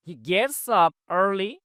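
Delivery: each word of "He lives in a small house" said very slowly and clearly; slow model reading. Shown as a demonstration. Teacher to Bangla class, a very slow model sentence. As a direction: dictate it word by word to students. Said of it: "teacher reads model sentence slowly".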